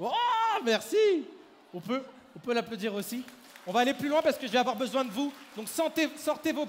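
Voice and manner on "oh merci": excited thanks. Real, not acted. Magician thanks volunteer after reveal.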